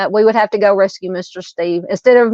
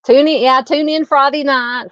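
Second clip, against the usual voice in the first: eager plug; 6 semitones higher than usual. Real, not acted. Inviting her viewers to the Friday stream, eager and higher. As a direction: pitch jumps higher on tune in.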